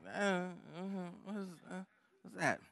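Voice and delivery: mock a baffled listener; confused listener. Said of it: "you're kinda like".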